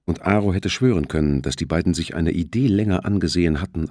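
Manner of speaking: ordinary delivery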